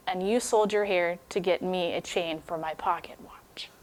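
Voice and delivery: soft whispered confession; husband whispering to his wife. Acted. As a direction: breathe the words out to his wife. Jim's tender reveal to Della.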